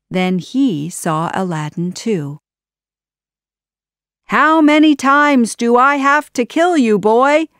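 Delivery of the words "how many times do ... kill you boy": high, loud villain's outburst; angry shout. A narrator's reading. Jafar spots Aladdin alive, exasperated shout. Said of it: "Jafar shouted".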